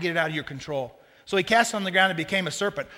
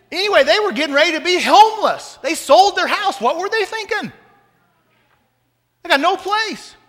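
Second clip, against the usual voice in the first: mock alarm; 11 semitones higher, 8 dB louder than usual. Mock alarm about homeless friends.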